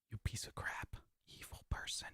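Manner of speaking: whispered insults, hushed and breathy; mock venomous whisper.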